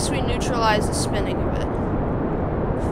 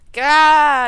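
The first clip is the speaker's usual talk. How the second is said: loud, higher cry of frustration; exasperated outburst; 5 semitones higher, 12 dB louder than usual. Real, not acted. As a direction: burst out in exasperation. Player annoyed by the game.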